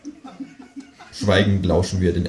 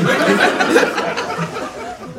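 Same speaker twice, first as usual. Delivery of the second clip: laugh; 12 semitones higher than usual. Real, not acted. Reader laughs at joke.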